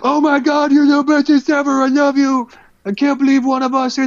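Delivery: high, excited starstruck fanboy squeal; gushing fans. Retold or imitated. Fans on the forum gushing over the new fighter, high excited squeal. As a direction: gush like a starry-eyed forum fan. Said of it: "They're like".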